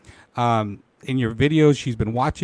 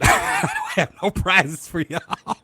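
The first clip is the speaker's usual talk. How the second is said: laughing; 4 semitones higher, slower than usual. Real, not acted. Laughs at her own joke.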